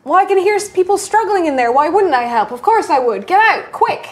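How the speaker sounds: urgent rescuer. Acted. Player voices character reassuring woman.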